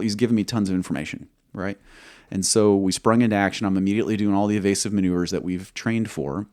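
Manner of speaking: usual speaking voice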